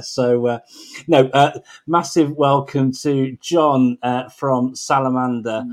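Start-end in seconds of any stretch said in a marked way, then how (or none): none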